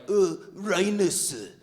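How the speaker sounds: very slow, hammed-up Cambodian accent; drawn-out mock Cambodian accent, L turned to R; bad fake Cambodian accent. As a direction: imitate dad faking a thick Cambodian accent. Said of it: "that's not even a good Cambodian accent".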